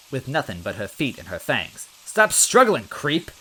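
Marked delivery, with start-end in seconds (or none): 2.0-3.3 loud, high snapped order; angry shout. Acted. Officer cuffing Luigi, angry loud shout. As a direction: yell at him to hold still.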